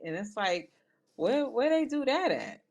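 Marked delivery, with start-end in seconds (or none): 1.1-2.6 high, disbelieving question; incredulous. Real, not acted. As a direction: ask it in pure disbelief.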